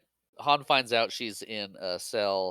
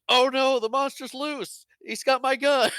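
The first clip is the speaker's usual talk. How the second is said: high, loud cartoon panic; hammed-up cry for help, pitched way up; mock alarm; 13 semitones higher, 6 dB louder than usual. Fake guard raising the alarm about the prisoner, mock alarm.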